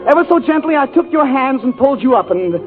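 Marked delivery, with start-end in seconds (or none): none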